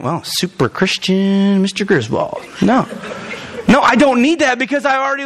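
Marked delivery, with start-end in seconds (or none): 0.0-2.4 slow sarcastic congregant voice; mock critic. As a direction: drawl it like a mocking churchgoer. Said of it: "some of you are going".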